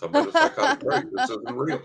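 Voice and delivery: high laughing squeal of disbelief. Retold or imitated. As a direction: laugh it out, half hysterical.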